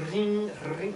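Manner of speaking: high, rolled-r imitation of a ring; old phone ring. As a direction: trill it like a telephone bell. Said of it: "distinct ring".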